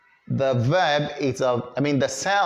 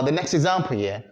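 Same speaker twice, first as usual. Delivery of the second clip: usual speaking voice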